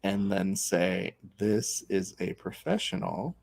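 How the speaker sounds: slow, low and hushed deadpan; deliberate teasing. Real, not acted. Host needles co-host over the pick, slow deadpan. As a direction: drag it out with long pauses.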